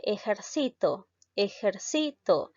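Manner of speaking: "ejercito" as e-jer-CI-to; slow demo of "ejercito", third syllable stressed; stress on penultimate syllable. Shown as a demonstration. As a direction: lean on the CI of ejercito. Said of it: "La mayor fuerza de voz recae en la sílaba sí".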